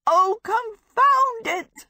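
high, loud, drawn-out exasperation; slow, high-pitched cry of annoyance. A narrator's reading. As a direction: wail the curse at full frustration. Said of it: "Sophie cried".

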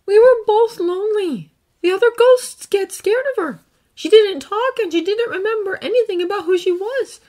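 put-on earnest boy, gently recounting; young hero voice. Izuku about Rei.

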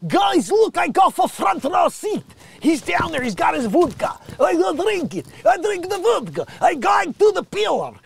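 high, loud hammed-up Russian voice; mock Russian accent. Igor calling out from the ship's bow, hammed-up Russian accent. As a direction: ham up a drunk Russian sailor. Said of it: "I bet you that's Igor".

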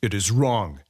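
ordinary delivery

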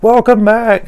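high, loud imitated cheer; excited greeting. Doctors and nurses welcome back the revived patient, excited mock call.

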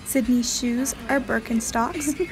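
normal talking voice

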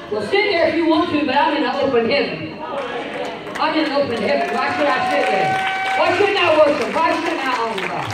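high, loud, fast retort to the sitters; rising preaching shout. Real, not acted. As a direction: build the fire, answer them loud.

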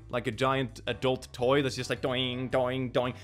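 ordinary delivery

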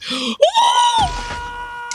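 sharp high gasp of fright; panicked intake of breath; terrified gasp. Real, not acted. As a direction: gasp as it catches you.

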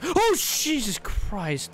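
gasp, then slow shocked curse; startled gasp. Real, not acted. Gamer startled by a customer he didn't see. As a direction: jump at someone suddenly there.

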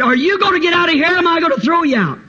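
fast, high-pitched ultimatum; heated, acted challenge; angry threat. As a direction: threaten to throw them out. Boy threatens the governor.

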